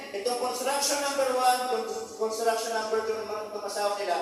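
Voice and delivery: ordinary talk, nothing marked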